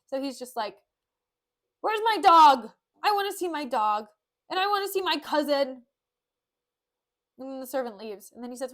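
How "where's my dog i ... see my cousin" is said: loud, higher, whining demands; hollered childish insistence, hammed up; mock tantrum. Petruchio demanding his dog and cousin, mock shouting.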